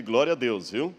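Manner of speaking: ordinary delivery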